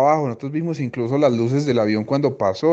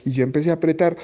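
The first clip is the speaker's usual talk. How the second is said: ordinary delivery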